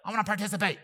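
eager, high and loud; pumped up and keen to join in; excited. Retold or imitated. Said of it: "I'm like".

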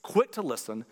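normal talking voice

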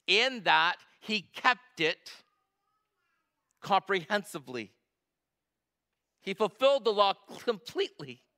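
preaching. Acted. Preacher to congregation, rising full-force preaching. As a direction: drive the point home to the congregation.

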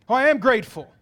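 loud, insistent self-defence; mock protest. Churchgoer defending his gratitude, loudly insistent. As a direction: insist on it at high volume.

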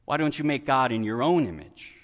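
ordinary delivery

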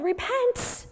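high, urgent preacher's cry; yelled warning. Acted. John calls people to repent in the desert, yelled warning. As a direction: call it out like a desert prophet. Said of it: "and he yelled".

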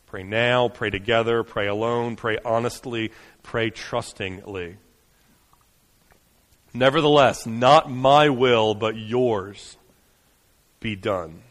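quiet reverent benediction; hushed prayer. Acted. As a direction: gently lead them into prayer. Closing quiet prayer call.

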